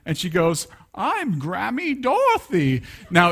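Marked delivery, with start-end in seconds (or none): 0.9-2.8 high, slow mock old-granny voice; a toddler's hammed-up great-grandmother impression, pitched way up; Jane imitating Grammy Dorothy. Dad mimicking his three-year-old playing great-grandmother, high drawn-out granny voice. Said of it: "that was Jane's impersonation of her".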